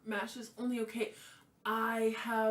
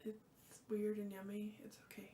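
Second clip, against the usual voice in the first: whisper; 9 dB quieter than usual. Real, not acted. Whispered aside about food.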